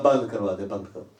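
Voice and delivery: ordinary talk, nothing marked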